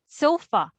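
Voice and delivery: "Sofa" with SO high, fa unstressed; first-syllable stress. Shown as a demonstration. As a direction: punch SO and let fa fall in "Sofa". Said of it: "Since we don't say sofa".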